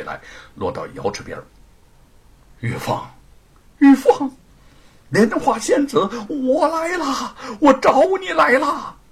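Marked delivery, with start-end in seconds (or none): 2.6-9.0 high, loud eager call; joyful calling out. Acted. Monkey King calling to the lotus fairy from the shore. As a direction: call to her from afar. Calling to her across lake.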